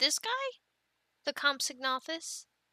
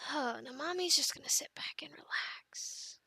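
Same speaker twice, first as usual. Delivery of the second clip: hushed confiding aside; whispered aside. Real, not acted. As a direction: whisper it to the camera like a secret. Aside while kids play.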